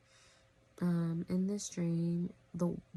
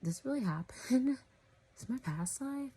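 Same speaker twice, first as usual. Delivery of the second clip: retold alarm; 7 semitones higher than usual. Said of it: "It's like".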